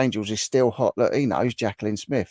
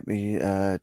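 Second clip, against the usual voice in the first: ordinary talk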